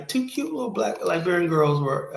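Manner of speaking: ordinary talk, nothing marked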